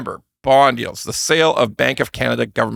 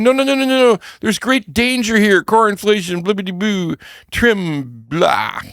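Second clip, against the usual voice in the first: lampoon an alarmed economist, high-pitched; mock fussy nerd; 8 semitones higher than usual. Mocking nerds' objections.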